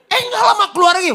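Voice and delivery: high, loud, fast exclaim; excited shout. Real, not acted. Preacher building to the punchline, fast excited shout.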